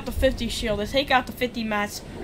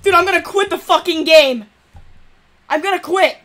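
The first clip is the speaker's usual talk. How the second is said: louder and higher, fed up; angry outburst; 5 semitones higher, 8 dB louder than usual. Real, not acted. Streamer threatening to quit the game, angry outburst. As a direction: snap it out like you're about to rage quit.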